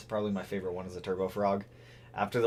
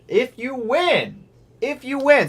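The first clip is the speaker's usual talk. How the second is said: playful; 12 semitones higher, 6 dB louder, slower than usual. Real, not acted. Playful motor bargain.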